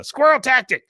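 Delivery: high, loud mock battle cry. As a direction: shout out a secret escape move. Dale announces escape move.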